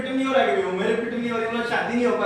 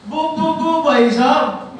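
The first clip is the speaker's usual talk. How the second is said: put-on stuttering boy; 5 semitones higher, 4 dB louder, slower than usual. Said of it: "तुतला के बोलता है".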